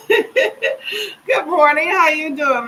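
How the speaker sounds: a drawn-out good morning through a laugh; slow, high laughing hello to the chat; laughing greeting. Real, not acted.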